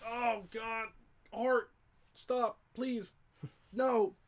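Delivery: high, strained mock plea to stop; overwhelmed pleading. Real, not acted. Pleading with co-host Art while choked up, high and strained. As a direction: beg him to stop, half laughing at your own tears.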